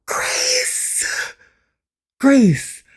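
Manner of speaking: high, shocked cry of Grace; character gasping and calling out to Grace; shocked shout. Acted. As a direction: cry her name in alarm. Character shrieking name.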